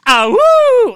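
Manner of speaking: high, loud howl; werewolf howl. Retold or imitated. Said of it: "I had a howl".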